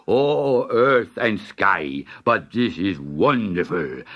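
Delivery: low, slow delighted sultan; grand languid royal commands. Acted. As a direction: declare it like a generous king. Sultan voiced in story.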